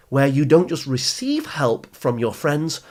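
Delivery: ordinary talk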